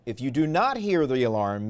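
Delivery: ordinary talk, nothing marked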